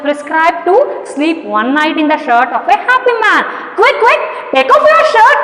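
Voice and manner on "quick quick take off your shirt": high, urgent, eager demand; urgent boy. Shown as a demonstration. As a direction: press him hard for the shirt like an eager boy. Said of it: "Observe the tone of that boy".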